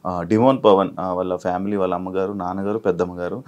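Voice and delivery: energetic anchor welcoming viewers; show host intro. Acted. Anchor greeting viewers at the show's start, upbeat host read.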